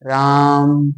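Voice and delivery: "राम" held long on a low, level note; long, sustained "राम", humming into the m; drawn-out vowel. Shown as a demonstration. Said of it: "थोड़ा लंबे करते हैं".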